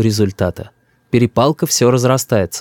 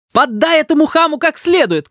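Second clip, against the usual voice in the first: very high, angry cry; shrill-pitched mother's urging; mother shouting. A narrator's reading. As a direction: egg him on furiously. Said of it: "кричит мама".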